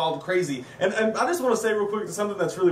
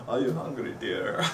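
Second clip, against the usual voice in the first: put-on low, soft creepy old-man voice, drawn out; hushed sinister old man; 6 semitones lower, 5 dB quieter, slower than usual. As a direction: coo it like a sinister grandpa. Old man coos to worm heart.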